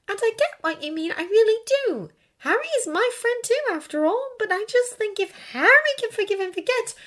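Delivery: put-on high-pitched, earnest girl's voice; earnest Hermione. A narrator's reading. Hermione urging Draco to forgive, earnest. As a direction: reason gently in a high voice.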